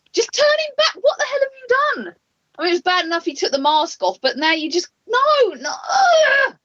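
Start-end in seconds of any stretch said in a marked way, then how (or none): 0.1-2.2 shouting at the TV. Retold or imitated. Fan yells at the TV over Kane's new look, desperate shout. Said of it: "I was just shouting at the T V going". 5.1-6.6 high, loud anguished cry of frustration; frustrated. Real, not acted. Fan rants to co-host about Kane, anguished frustrated cry. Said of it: "Oh, I'm just frustrated".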